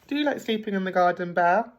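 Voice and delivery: ordinary delivery, nothing marked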